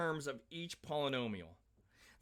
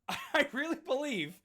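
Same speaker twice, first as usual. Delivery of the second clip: laughing; 9 semitones higher than usual. Real, not acted. Laughs at own hamburger idea.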